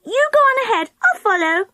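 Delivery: put-on high child's voice calling ahead; little girl calling. A narrator's reading. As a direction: call out a fib to the others. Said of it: "she called to the others".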